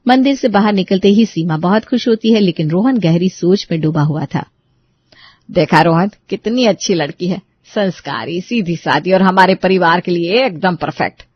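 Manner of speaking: usual speaking voice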